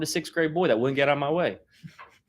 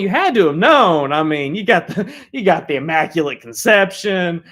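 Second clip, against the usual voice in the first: ordinary talk, nothing marked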